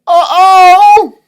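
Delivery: high, loud alarmed uh-oh. Acted. Reader reacting as the iceberg sinks, loud uh-oh. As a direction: sound the alarm. Iceberg sinking, reading to kids.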